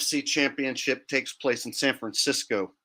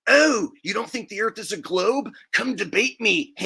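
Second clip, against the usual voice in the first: higher, whiny mock challenge; mocking smug troll; 7 semitones higher than usual. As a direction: parody a smug flat-earther. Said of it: "guys who are like".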